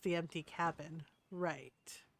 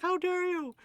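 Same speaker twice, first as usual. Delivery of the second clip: much higher hammed-up indignant voice; mock outrage; 11 semitones higher, 4 dB louder than usual. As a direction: play the offended crook, squeaky. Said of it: "He's like".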